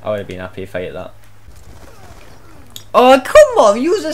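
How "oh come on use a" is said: higher and louder cry of frustration; high, loud oh come on; exasperated shout. Real, not acted. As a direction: throw it out at the screen. Frustrated at enemy.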